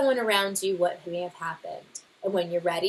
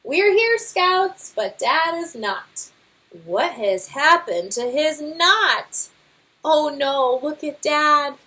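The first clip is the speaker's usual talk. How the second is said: high, louder calling out in worry; alarmed cub; 7 semitones higher, 6 dB louder, slower than usual. Acted. Cub calling out as his father's knot fails, alarmed. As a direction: shout as Dad falls.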